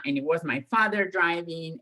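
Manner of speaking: usual speaking voice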